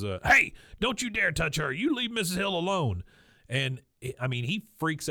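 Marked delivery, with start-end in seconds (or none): 0.2-3.0 high, loud mock yell of defiance; hammed-up outburst; mock angry yell. Wes yelling at the guard, mock angry. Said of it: "gets super mad, and he goes".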